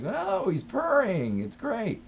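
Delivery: breezy, carefree reassurance; mock chirpy reply.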